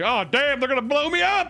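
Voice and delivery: high mock-panicked cry, rising; exaggerated shout of doom; mock alarm. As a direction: yelp it as a hammy imitation. Imitating man about to be bombed.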